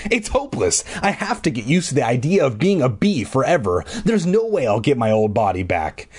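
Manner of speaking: ordinary delivery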